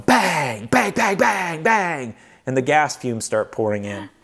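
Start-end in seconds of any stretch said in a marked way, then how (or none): 0.1-2.1 quick punchy bang noises, pitched up; imitated engine backfire. Telling the audience how the Winnebago sounded, mock backfire bangs. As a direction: mimic an old motorhome backfiring. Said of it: "Winnie starts going".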